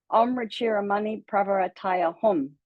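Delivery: Om Ruchira Mani Pravarataya Hum with Ruchira said "rətɪɹə"; mantra as she learned it. Shown as a demonstration. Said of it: "this is how I, I know it".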